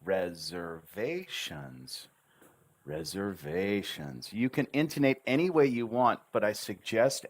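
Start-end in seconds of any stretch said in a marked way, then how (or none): 0.0-4.3 RESERVATIONS very slow, pitch moving on every syllable; deliberate, melodic RESERVATIONS; intonation on each syllable. Shown as a demonstration. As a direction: stretch RE-ZER-VA-TIONS with a changing tune. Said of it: "Notice I intonate on each syllable".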